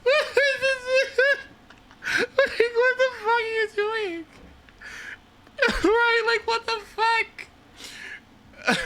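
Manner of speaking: very high, squeaky, laughing through words; laughing disbelief. Real, not acted. Streamer in disbelief at Miss Piggy, squeaky laughing.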